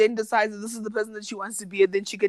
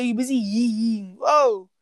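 normal talking voice

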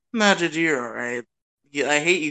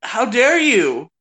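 slow, loud, hammed-up indignation; mock outrage; 6 semitones higher, 4 dB louder, much slower than usual. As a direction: drag it out, playfully offended. Mock outrage about Pichu.

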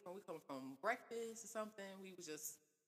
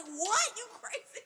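high, loud, incredulous outburst; retold outrage; 9 dB louder, slower than usual. Woman replaying her answer to boyfriend's confession, outraged. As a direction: burst out in disbelief, half laughing. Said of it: "I was like".

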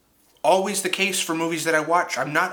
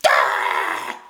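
mock scream; 10 semitones lower, 4 dB louder than usual. Imitating her audition scream.

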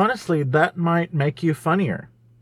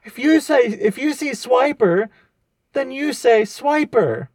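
Dora host voice; 7 semitones higher than usual. Pretend.

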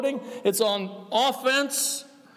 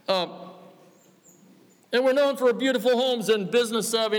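normal talking voice

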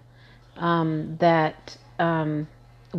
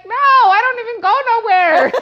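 high, louder squeaky protest from the husband; mock husband protesting; 6 dB louder than usual. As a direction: play the husband whining his innocence. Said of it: "And he's like".

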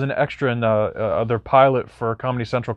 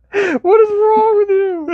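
high, slow squeal through laughter; drawn out, breaking into laughter; laughing; much slower than usual. Real, not acted. Cracking up over creamsicle joke.

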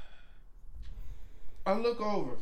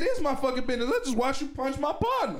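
high, raised angry retort; 9 semitones higher than usual. Retold or imitated. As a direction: push back hard at her. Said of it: "I said".

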